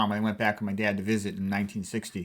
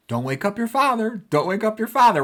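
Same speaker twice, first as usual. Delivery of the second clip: high, sharp scolding, much higher pitch; mock angry mom; 10 semitones higher, 4 dB louder than usual. Mother scolding sneaking kids in mock anger. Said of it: "if she was mad at us".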